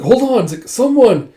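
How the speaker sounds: high, loud acted call for help; urgent calling out. She calls someone for the feverish man, urgent shout. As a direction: call out urgently, as in a retold emergency.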